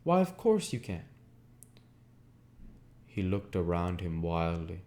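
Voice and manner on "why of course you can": much higher, louder Gatsby, certain; eager insistence. A narrator's reading. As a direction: brush the doubt aside with fervour. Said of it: "he cried incredulously".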